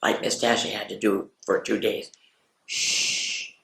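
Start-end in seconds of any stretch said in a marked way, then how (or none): 2.6-3.5 soft unvoiced whisper; breathy secret whisper. Shown as a demonstration. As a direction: hush it like a secret. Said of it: "you're gonna whisper it".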